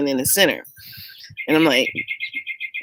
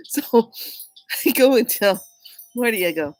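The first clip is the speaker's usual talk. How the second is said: ordinary talk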